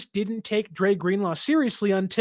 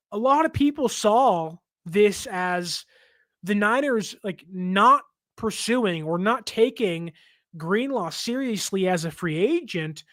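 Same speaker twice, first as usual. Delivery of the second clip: ordinary delivery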